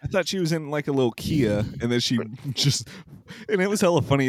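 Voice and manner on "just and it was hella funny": ordinary talk, nothing marked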